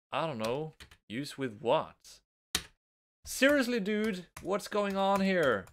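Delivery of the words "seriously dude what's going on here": exasperated. Real, not acted. Frustrated with frozen game.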